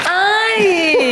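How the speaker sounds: long high-pitched "ai!" squeal. Real, not acted. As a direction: let out a drawn-out, high squeal. Reaction to secret name revealed.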